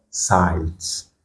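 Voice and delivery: "site" with i drawn out as eye; English I sound. Shown as a demonstration. Teacher shows learners the second sound, "site" with a long eye vowel. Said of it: "la lettre va prendre son son en anglais".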